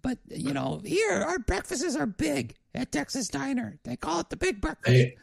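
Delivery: high falsetto waitress, slowing for emphasis; diner waitress. Acted. Waitress warns the Ghost about huge breakfasts, high-pitched waitress voice. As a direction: warn the customer in a high put-on voice.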